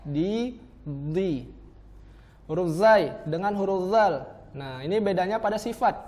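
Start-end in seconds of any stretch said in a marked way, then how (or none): 0.1-1.5 a light "di", then a heavy, darker "di"; thin "di" first, thick "di" after; dal versus dot. Shown as a demonstration. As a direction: drill contrasting dal and dot. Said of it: "Beda ya".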